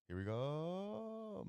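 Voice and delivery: high, slow stretched call; drawn-out call. Real, not acted. Streamer bracing for the next play, high slow drawn call. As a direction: stretch the words up like an announcer.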